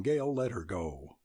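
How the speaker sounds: ordinary delivery, nothing marked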